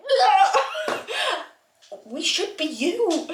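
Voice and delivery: high-pitched wail breaking into laughter; mock despair. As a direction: wail it in playful protest. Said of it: "I'm like".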